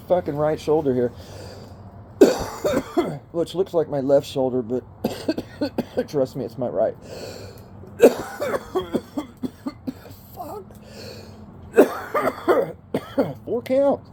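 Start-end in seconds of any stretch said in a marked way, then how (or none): 2.2-3.3 hacking smoker's cough; ragged coughing fit; harsh cough. Real, not acted. 5.0-6.0 hacking smoker's cough; ragged coughing fit; harsh cough. Real, not acted. 8.0-9.9 hacking smoker's cough; ragged coughing fit; harsh cough. Real, not acted. 11.7-12.7 hacking smoker's cough; ragged coughing fit; harsh cough. Real, not acted.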